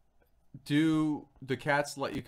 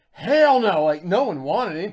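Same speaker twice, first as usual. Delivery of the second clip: loud mock yell of refusal; shouted refusal; 6 semitones higher, 14 dB louder than usual. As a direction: bark it out like a crowd saying no. Crowd refusing a donation call.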